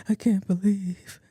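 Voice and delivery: high, slow mock sob; imitated crying. Friend can't explain the tears, mock crying. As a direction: drag it out like a weepy caller. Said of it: "kind of tears".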